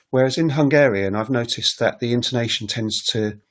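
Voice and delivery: usual speaking voice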